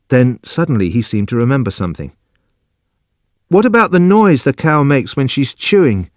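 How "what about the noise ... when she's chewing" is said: high, fretful simple man; worried Judson. Acted. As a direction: raise the voice high, anxious about the plan. Narrator voices Judson worrying.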